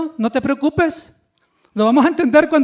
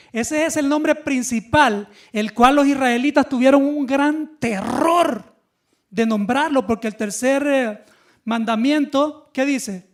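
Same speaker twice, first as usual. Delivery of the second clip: ordinary delivery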